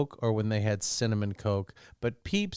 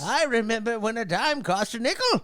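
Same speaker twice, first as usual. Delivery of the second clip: put-on lightly higher nostalgic old man; old-timer voice; 9 semitones higher than usual. Riffing on old ads, old-timer reminiscing. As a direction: reminisce like a grandpa.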